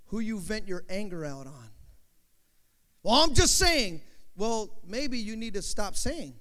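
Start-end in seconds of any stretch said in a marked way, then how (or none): 3.0-4.0 loud, higher-pitched excuse; mock defensive retort. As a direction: imitate someone protesting innocence. Mimics someone defending their venting.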